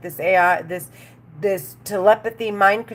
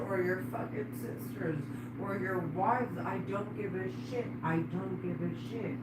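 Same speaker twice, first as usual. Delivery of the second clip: much softer, low, spitting out the threats; hushed furious rant; 3 semitones lower, 16 dB quieter than usual. Real, not acted. Woman cursing the voices in her head, hushed and furious. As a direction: keep it quiet but seething with rage.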